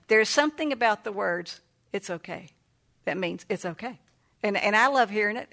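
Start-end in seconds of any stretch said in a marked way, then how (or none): 1.9-2.5 hushed reassurance. Retold or imitated. Quoting soothing words.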